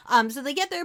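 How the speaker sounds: ordinary talk, nothing marked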